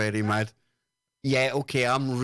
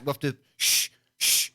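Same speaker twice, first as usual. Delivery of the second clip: normal talking voice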